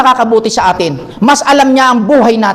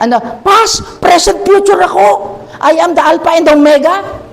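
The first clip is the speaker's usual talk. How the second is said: mock Jesus scolding; 8 semitones higher than usual. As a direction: tease the disciple for skipping Bible study. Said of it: "kung ito lang si Jesus, sasabi niya". (pabirong sermon ni Hesus, mataas at malakas)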